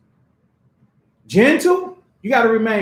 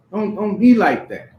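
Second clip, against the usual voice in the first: usual speaking voice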